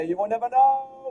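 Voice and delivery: high teasing villain voice; taunting voice. Acted.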